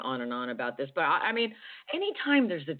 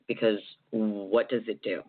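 ordinary talk

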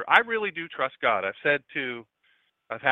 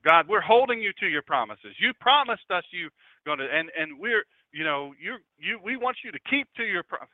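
imitating pushy, raised-pitch demands on God; demanding prayer; 5 semitones higher than usual. Preacher imitates churchgoers holding God to promises, demanding. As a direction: press God insistently, as an imitated congregant. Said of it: "they're demanding of God".

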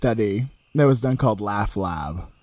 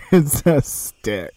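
laughing; much slower than usual. Real, not acted. Laughing punchline.

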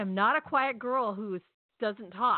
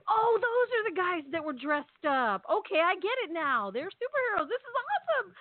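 high-pitched, delighted dawning realization; excited realization; 9 semitones higher than usual. Retold or imitated. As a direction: climb higher as the excitement grows. Acting out her delighted realization.

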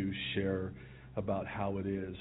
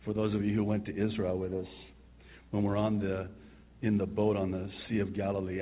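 ordinary talk, nothing marked

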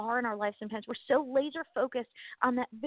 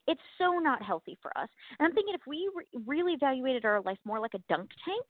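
normal talking voice

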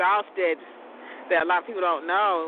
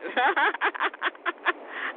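high giggle; 9 semitones higher than usual. Real, not acted. Laughs that protein costs money.

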